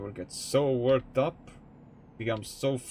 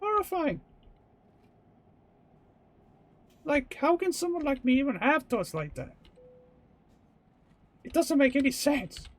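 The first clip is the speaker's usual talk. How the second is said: much higher, fast squeaky horror, hammed-up; mock horrified; 14 semitones higher than usual. Reacting to their own menacing thoughts, mock horrified squeak. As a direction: feign shock at your own dark mind.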